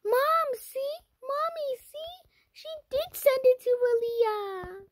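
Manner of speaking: high, teary tattling wail; crying little sister. Retold or imitated. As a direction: imitate the sister sobbing as she tells on her.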